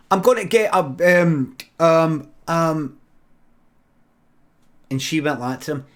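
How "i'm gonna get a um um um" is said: loud, high, stalling reply; calling back. Acted. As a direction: shout back while fumbling for an excuse. Acts man calling back, stuck for excuse.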